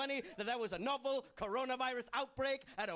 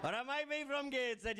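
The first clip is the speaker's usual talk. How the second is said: Geordie accent; 3 semitones higher than usual. Shown as a demonstration. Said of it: "perfectly interchangeable".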